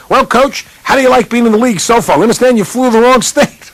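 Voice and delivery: high, loud mocking reporter voice; news crew ribbing the coach about the wrong state; teasing reporter. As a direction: needle the new coach with a grin in your voice.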